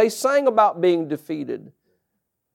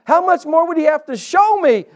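higher, louder preaching build; rising pulpit preaching; 8 semitones higher, 3 dB louder than usual. Acted. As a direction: lift it and drive the point home. Preaching climax to congregation.

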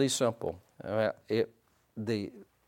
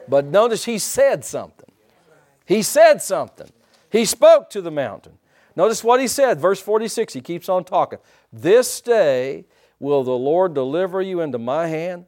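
loud, higher, driving sermon voice; full-force preaching; 6 semitones higher, 8 dB louder than usual. Acted. Preacher stirs his church about David's words, loud preaching. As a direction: preach it out with fire.

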